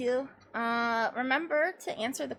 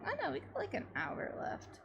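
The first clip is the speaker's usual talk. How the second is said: ordinary talk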